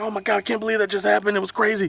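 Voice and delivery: high, fast retold amazement; really excited. Teens amazed the cops let them go, high excited gushing. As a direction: gush in a rush of disbelief. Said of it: "really excited".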